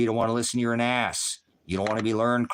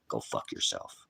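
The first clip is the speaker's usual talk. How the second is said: much lower and softer, almost under the breath; low, soft sign-off; 13 semitones lower, 9 dB quieter than usual. Real, not acted. Brushing off a chat troll, low and soft. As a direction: drop it low and soft, dismissive.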